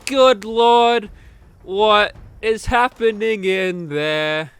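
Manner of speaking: hammed-up shock at the blaze; loud mock alarm; alarmed shout. Chalmers sees the fire.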